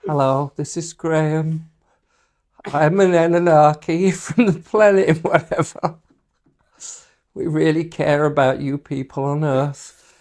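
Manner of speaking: mock channeling voice on the edge of giggles; straight-faced channeled alien. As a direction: deliver the alien's greeting with a fought-for straight face. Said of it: "I have tried to keep a straight face".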